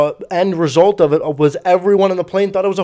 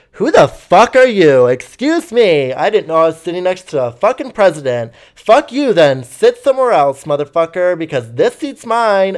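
loud, higher-pitched mock indignation; offended outburst; 4 semitones higher, 5 dB louder than usual. Drunk passenger snapping at his seatmate, loud indignant imitation. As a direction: imitate an affronted rant, stretching the opening words.

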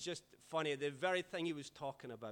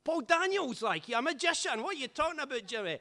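much higher, loud, scoffing slur; drunk Scotsman; 11 semitones higher, 8 dB louder than usual. Retold or imitated. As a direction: drunk pal mocking a magician. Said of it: "drunk pal mocking".

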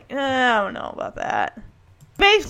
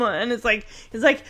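ordinary delivery

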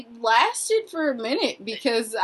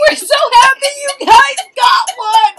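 much higher, louder happy cheer; cheering employees; 12 semitones higher, 8 dB louder than usual. Retold or imitated. Employees cheering for the winners, high and loud. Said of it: "They're like".

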